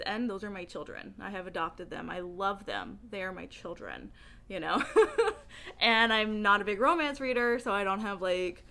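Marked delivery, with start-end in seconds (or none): 4.9-5.8 loud burst of laughter; a hearty laugh. Real, not acted. Laughing about adopted book kids.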